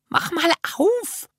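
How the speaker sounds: put-on very high, slow, piping child voice; squeaky voice. A narrator's reading. Jana asking to open the plastic sack, squeaky. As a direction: squeak it out thinly, stretching the last word. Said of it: "Janas Stimme klang ein bisschen piepsig".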